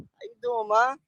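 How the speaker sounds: high, playful how you doing ma; hammed-up two-thousands pickup line; mock smooth pickup line. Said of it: "we used to do that back in the days".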